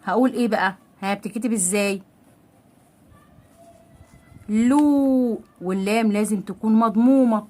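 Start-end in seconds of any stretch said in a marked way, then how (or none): 4.5-5.4 "لو" on a long, higher oo; long waw madd. Shown as a demonstration. Teacher showing waw madd, long high "لو". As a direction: sustain "لو" as a raised, stretched loo. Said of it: "مع المد بالواو".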